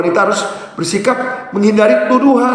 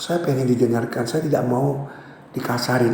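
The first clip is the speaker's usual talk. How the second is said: softer, gentle statement of need; soft plea; 7 semitones lower, 8 dB quieter than usual. Shown as a demonstration. Wife telling husband her need, softly and gently. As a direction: ask quietly to be heard. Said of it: "Bicara apa yang kau butuhkan".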